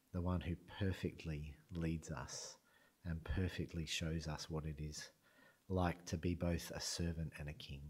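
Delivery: ordinary delivery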